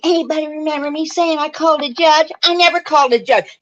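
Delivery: high-pitched mock of another woman; mocking quote. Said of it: "old gal just made me furious. Saying".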